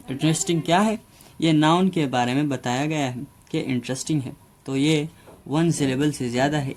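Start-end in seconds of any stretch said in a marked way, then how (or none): none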